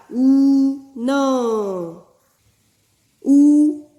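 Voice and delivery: ऊन with the ऊ held long and loud. Shown as a demonstration. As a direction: stretch ऊ in ऊन clearly. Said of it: "दीर्घता के साथ हमें करना पड़ेगा".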